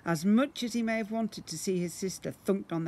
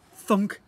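loud thunk; 5 semitones higher, 9 dB louder than usual. A narrator's reading. Book drops, sound effect.

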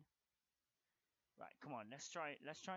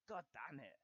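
ordinary talk, nothing marked

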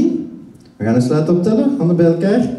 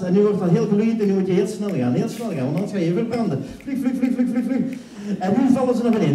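lively stage hypnotist painting a circus; hypnotic scene narration; 5 semitones higher, 5 dB quieter than usual. Acted. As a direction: sweep them into the circus scene.

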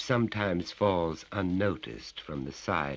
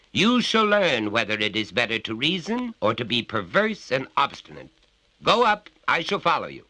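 raised, commanding father; stern order; 7 semitones higher, 7 dB louder than usual. Acted. Father orders his son upstairs, commanding. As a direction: send him upstairs like a judge.

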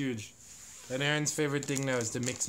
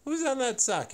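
much higher, louder sing-song question; 12 semitones higher, 6 dB louder than usual. Real, not acted. Mother asks toddler again.

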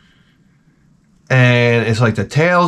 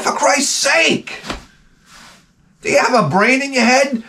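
exasperated scolding; 13 semitones higher than usual. Retold or imitated. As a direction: lose patience with the dog. Scolding the dog.

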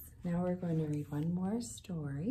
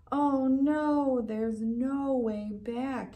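louder oh no, then slow; dismayed; 5 semitones higher, 5 dB louder, much slower than usual. A narrator's reading. Elmer trapped in the cave, slow dismay. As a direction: realize you're stuck. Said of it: "he said".

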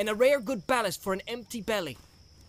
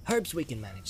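usual speaking voice

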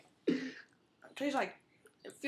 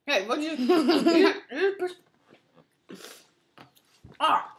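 loud, laughing outcry; laughing shout; 7 semitones higher, 13 dB louder, slower than usual. Real, not acted. Tasting a gross surprise.

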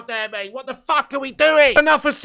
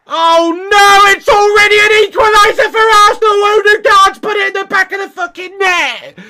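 loud, higher cry of dismay; dismayed shout; 6 semitones higher, 6 dB louder than usual. Real, not acted. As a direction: yell as the equaliser goes in. Reacting live to equaliser.

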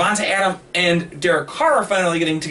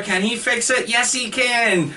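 high call-and-response catchphrase; Bob the Builder; 6 semitones higher, slower than usual. Acted. Co-host quoting the kids' show to explain his pun, high catchphrase chant. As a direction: pitch it up and stretch the answer. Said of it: "like Bob the Builder".

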